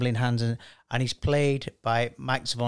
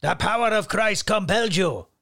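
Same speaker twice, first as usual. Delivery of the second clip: higher, louder mock Anthony Hopkins exorcist line; Anthony Hopkins impression; 5 semitones higher, 3 dB louder than usual. Host playing his picked actor Anthony Hopkins for the panel, hammed-up impression. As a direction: ham up Hopkins commanding the demon. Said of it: "I've picked Sir Anthony Hopkins".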